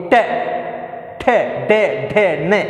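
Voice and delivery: टे ठे डे ढे ने with the tongue tip curled back to the roof; teacher sounding out टे ठे डे ढे ने; retroflex syllables. Shown as a demonstration. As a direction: sound out टे ठे डे ढे ने as a chant. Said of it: "इसको बोलिए".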